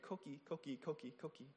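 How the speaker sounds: soft high chirping frog call; coqui frog call. Shown as a demonstration. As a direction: imitate the frog's two-note chirp. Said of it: "that's the noise they make".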